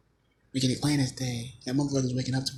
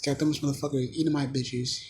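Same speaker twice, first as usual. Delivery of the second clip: ordinary talk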